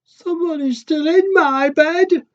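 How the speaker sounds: very high, loud, slow squeal; baby bear alarmed, squealing to his parents. A narrator's reading. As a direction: cub shrieking at the girl in his bed. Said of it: "Little baby bear says".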